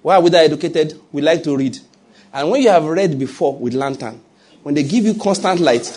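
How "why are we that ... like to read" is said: ordinary talk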